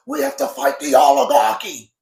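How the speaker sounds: loud rallying shout, voice pitched low; mock Bernie shout. Bernie Sanders at a rally, hammed-up shouted slogan. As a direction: bellow it like a stump speech. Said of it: "Bernie Sanders, God bless him".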